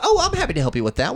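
high, fast chirpy customer service voice; overly cheerful agent. Retold or imitated. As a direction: rattle off the canned cheer. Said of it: "They're like".

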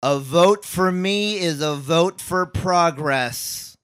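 very slow, loud and high oration; booming campaign speech. Shown as a demonstration. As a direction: bellow it to a rally crowd. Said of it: "I want you to really".

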